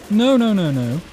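high, loud rapid panic; panicked no. Real, not acted. Gamer panicking as the witch attacks, loud and high. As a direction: yelp it in fright.

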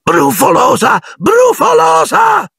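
very high jeering cry, second one drawn out; poltergeist's shrieked teasing; taunting yell. A narrator's reading. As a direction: chase her with a high-pitched shout. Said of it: "la rincorse gridando".

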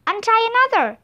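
urgent shouted instruction to Jack. A narrator's reading. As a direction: call it out over the wind. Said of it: "shouted Teddy".

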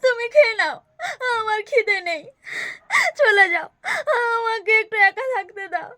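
crying, pushing the listener away; weepy, upset refusal to eat; tearful refusal. Acted. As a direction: sob through a plea to be left alone. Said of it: "তুমি আর কেঁদো না".

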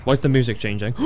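usual speaking voice

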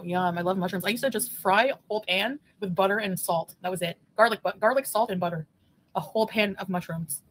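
ordinary delivery

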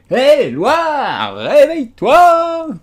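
high, loud, drawn-out call to a sleeper; wake-up shout. A narrator's reading. Friends shouting at the sleeping Dormouse, high and loud. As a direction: yell him awake, stretching it out.

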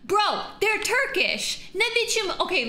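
real excited high shriek. Real, not acted. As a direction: squeal it in disbelief. Shrieks recognizing Turks.

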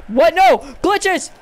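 high, loud burst of disbelief; startled protest. Real, not acted. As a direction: cry out in sudden gamer outrage. Sudden mishap in the game.

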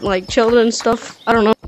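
usual speaking voice

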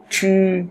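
"chư" with tongue curled back, unaspirated; curled-tongue sound. Shown as a demonstration. Teacher drills students, "chư" retroflex and unaspirated. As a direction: hold "chư" high and level, no air puff. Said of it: "Các bạn quặt lưỡi lên".

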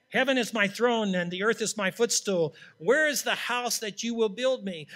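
loud, proclaiming reading of the verse; scripture reading. Acted. Preacher reading Isaiah to the church, proclaiming loudly. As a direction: read it out like God is speaking. Reads scripture aloud forcefully.

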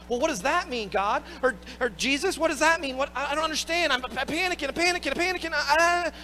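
a frightened follower who can't make sense of it; panicking disciple. Acted.

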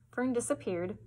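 ordinary delivery, nothing marked

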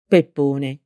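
ordinary delivery

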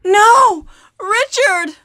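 loud, desperate cry of no; anguished shout. Acted. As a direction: scream it as he dies. Jill screams as Richard dies.

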